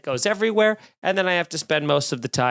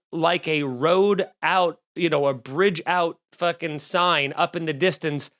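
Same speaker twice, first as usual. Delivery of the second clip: ordinary delivery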